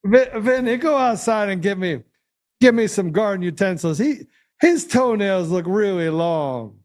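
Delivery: high-pitched put-on mob boss; mobster accent. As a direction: order the henchman about like a movie gangster. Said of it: "the best accent I've heard you do".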